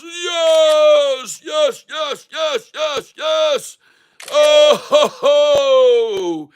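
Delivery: high, loud ho-ho and yes-yes cries; triumphant whooping. Real, not acted. Host celebrating breakout with viewers, high triumphant shouts. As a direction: bellow it out like a gloating victor.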